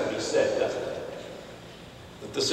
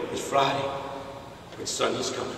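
soft preaching; 2 semitones lower, 10 dB quieter than usual. Retold or imitated. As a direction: say it gently, holding power back. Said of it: "He started nice and soft".

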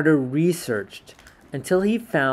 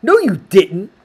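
loud, high, sharp retort; small boy snapping back; 7 semitones higher, 11 dB louder than usual. Acted. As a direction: snap it back like an upset kid. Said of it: "snapped Sam".

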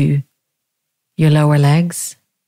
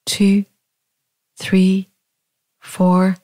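"two, three, four" held up in pitch, spaced evenly; in-breath count; 3 semitones higher, much slower than usual. Shown as a demonstration. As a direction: count "two, three, four" on a lifted note, with pauses, as if filling the lungs.

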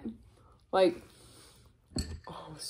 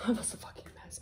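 1 laughing whisper; 3 semitones higher, 11 dB quieter, faster than usual. Real, not acted. Laughing about being a mess.

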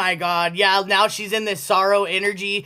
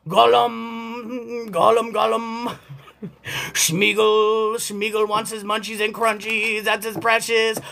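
Gollum impression; slower than usual. Imitating Gollum.

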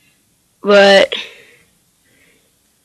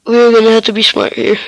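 usual speaking voice

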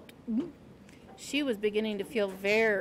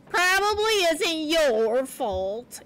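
slow, drawn-out grudging concession; grumbling; 4 semitones higher, 3 dB louder, slower than usual. A narrator's reading. Porcupine grudgingly excusing Poppy, grumbling. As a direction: grumble it out slowly, still emphatic. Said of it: "grumbled in a somewhat softer tone".

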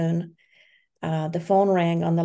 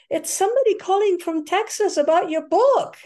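raised, higher voice calling up to her; husband calling out from downstairs; 10 semitones higher, 5 dB louder than usual. Retold or imitated. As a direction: call the news across the house.

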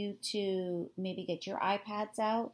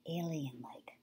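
whispering; 3 semitones lower, 5 dB quieter, slower than usual. Pretend. Teacher shows off alien effect.

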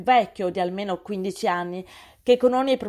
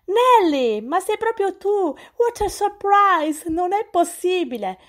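loud, high cry of amazed recognition; big surprised hello, stretched out slow; astonished shout; 9 semitones higher, 3 dB louder, slower than usual. A narrator's reading. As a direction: call out across the room, stunned to see her. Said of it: "una voce stupita gridò".

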